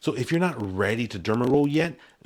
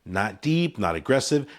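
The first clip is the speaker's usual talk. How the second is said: usual speaking voice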